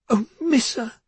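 high, anguished cry to her daughter. Acted. Mother cries out to hurt daughter, anguished. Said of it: "Yasmina cried".

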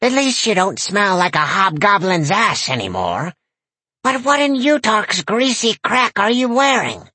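high, crabby granny voice; a foul-mouthed old crone scolding; cranky old lady. A narrator's reading. Granny character voice.

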